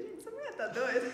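half-laugh it out in disbelief; amused shock at a crying mother. Retold or imitated.

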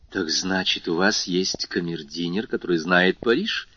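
usual speaking voice

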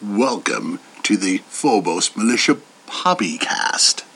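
deep welcome; deep announcer. Pretend. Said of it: "Finally got it".

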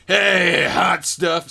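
loud sleazy come-on; sleazy flirt. Retold or imitated. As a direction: call out like a cheesy flirt. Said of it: "he says to the receptionist".

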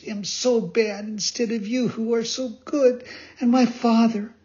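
put-on high-pitched puppet boy, wailing in grief; sobbing lament. Pinocchio grieving to the dead fairy, high sobbing lament. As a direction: plead through mock sobs in a high little voice. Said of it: "And as he sobbed".

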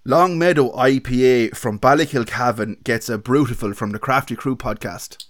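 mock Laois accent podcast outro. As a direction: announce the verdict like a hammed-up Laois local. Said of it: "give us an outro in your best Laois accent".

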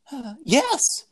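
put-on high hesitant boy; nervous high yes. Voicing Ken.

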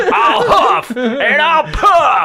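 high, slow shouted threat; huffing wolf voice. Pretend. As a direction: ham it up like the big bad wolf. Big bad wolf parody.

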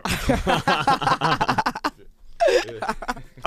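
real high, loud laughing shriek; cracking up at a friend's story, shrieking. Real, not acted. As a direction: burst into a helpless high laugh. Shrieking laugh after lakrids story.

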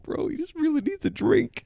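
very high, cracking, near tears; squeaky high crying plea; tearful pleading. Pretend. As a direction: beg with a voice breaking into tears. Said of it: "starting to tear up".

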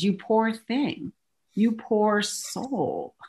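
hammed-up pitying coo; exaggerated pity. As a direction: talk down with mock sympathy. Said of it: "I exaggerated".

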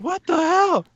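high-pitched retold shock; shocked disbelief. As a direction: squeal it in mock disbelief. Acting out shocked reaction.

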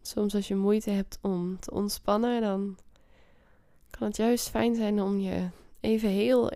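guided meditation. Acted. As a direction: ease them into calm. Leads a meditation.

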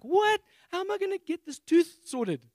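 squeaky high alarmed "What?"; shocked panic. Retold or imitated. Preacher mocking his own panic over a tooth, high and shrill. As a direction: voice jumps way up in disbelief. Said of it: "So I'm like".

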